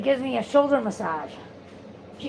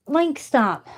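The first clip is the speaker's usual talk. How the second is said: sharp call to the dog, higher; 6 semitones higher, 4 dB louder than usual. Real, not acted. Calling the dog off.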